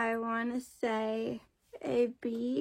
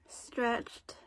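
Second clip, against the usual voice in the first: ordinary talk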